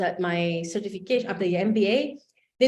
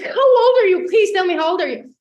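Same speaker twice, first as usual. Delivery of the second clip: very high, loud, excited begging; urgent pleading; 10 semitones higher, 9 dB louder than usual. Retold or imitated. Woman desperate to know the founder's age, urgent pleading. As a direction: beg loudly for the answer.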